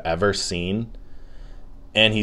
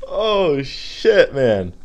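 high, amused exhale after a laughing fit; coming out of laughter; 7 semitones higher, much slower than usual. Real, not acted. Podcaster winding down from his own joke, high and laughed-out. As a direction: still tickled, pitched way up.

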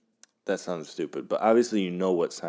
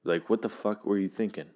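ordinary talk, nothing marked

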